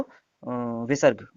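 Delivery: अ said slowly with a breathy visarga release; drawn-out अ ending in a breathy h; visarga sound demo. Shown as a demonstration. Teacher demonstrates visarga to students, slowly. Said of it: "दूसरे वाले को हम विसर्ग कहते हैं".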